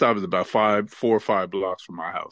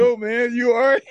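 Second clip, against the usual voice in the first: higher voice calling across the street; imitating bus stop guy; 13 semitones higher, 4 dB louder than usual. Bus stop guy calls out to fallen narrator, mock concern. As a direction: call over, amused concern.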